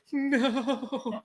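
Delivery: long, very high whimpered no; pretend-crying thin wail; mock wailing despair. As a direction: drag it out like a hammed-up sob. Retold despair at redoing work.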